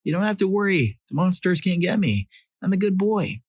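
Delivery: put-on high-pitched small child voice; earnest high kiddie voice; little boy reassuring mom. A narrator's reading. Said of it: "I ran, screaming across the house".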